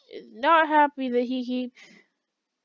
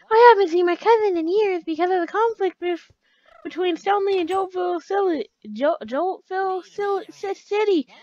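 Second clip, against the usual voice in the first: high, loud put-on character voice; stumbling through the place name in character; game townsperson voice; 7 semitones higher, 7 dB louder than usual. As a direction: read it in a raised, strained character voice. Voices a creepy game character's dialogue.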